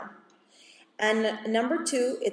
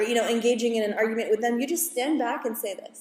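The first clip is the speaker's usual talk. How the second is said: normal talking voice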